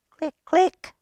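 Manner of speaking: high clicky tapping sounds; keyboard clicks. A narrator's reading. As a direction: click like computer keys.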